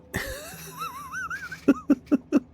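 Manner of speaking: squeaky disbelieving laugh, way up high; high incredulous laugh. Real, not acted. Streamer laughs at his broken rail line, high squeaky laugh. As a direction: let out a high, helpless laugh.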